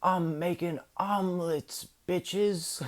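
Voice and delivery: high, drawn-out holler; imitated yell. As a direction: yell it like a rowdy uncle announcing himself. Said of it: "and he just yells".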